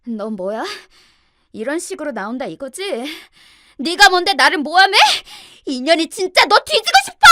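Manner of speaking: indignant challenge building into a high, very loud scream; furious yelling. A narrator's reading. Sister-in-law turning on the narrator at the party, furious screaming. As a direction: explode in rage at the accuser. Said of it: "저에게 돌연 소리를 질러댔어요".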